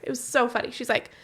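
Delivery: ordinary delivery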